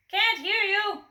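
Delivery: loud, high boom. A narrator's reading. Butcher booming at the customer. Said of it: "boomed the butcher".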